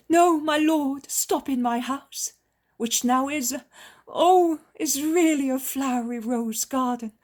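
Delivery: woman pours herself out in gratitude; joyful healed woman. A narrator's reading. Healed woman begs Jesus to stay.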